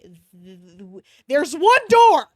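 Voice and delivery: loud stammering exasperated rant; frustrated outburst. Real, not acted. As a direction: blow up at the characters, slowing down. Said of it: "it's the fucking library".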